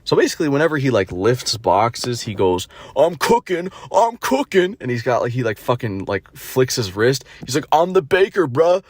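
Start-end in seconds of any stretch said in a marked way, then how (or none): 3.0-4.8 high-pitched mocking brag; mimicked cringe boast. Mocking him lifting boxes, high cringe boast. 7.7-8.8 higher-pitched mocking brag; mimicked cringe boast. Mocking him flicking his wrist, higher cringe boast.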